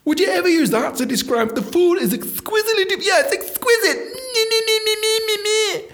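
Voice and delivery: high, far posh voice, sliding into whiny me me me; posh mockery. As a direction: lampoon the snobby diners, high and prissy. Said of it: "they were so posh".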